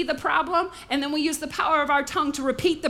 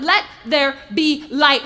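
slow, much louder proclamation; bold command; 8 dB louder, slower than usual. Acted. Preacher voicing God at creation, slow and booming. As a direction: boom it out slowly.

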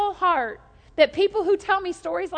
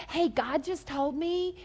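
softer, slow mock-reverent confiding; imitated confider; 6 dB quieter, slower than usual. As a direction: confide it slowly, as a would-be messenger. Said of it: "somebody tells me, like".

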